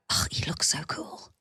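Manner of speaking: put-on hushed, breathless whisper of a boy in awe; awed whisper. Mother voicing her son's awed thought in a whisper. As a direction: whisper it like a starstruck kid.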